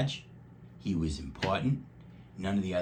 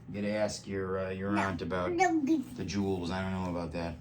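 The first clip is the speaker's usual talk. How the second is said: usual speaking voice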